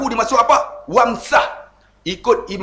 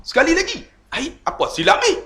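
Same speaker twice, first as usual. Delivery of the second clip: much higher, puzzled and fed up; exasperated high voice; 11 semitones higher, faster than usual. Retold or imitated. Preacher retelling his confusion at repeating prayer, high exasperated. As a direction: rise high in mock frustration. Frustrated at being sent again.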